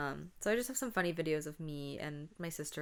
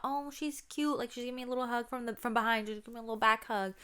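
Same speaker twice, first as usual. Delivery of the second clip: put-on naive little girl; 6 semitones higher, faster than usual. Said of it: "Like, I thought".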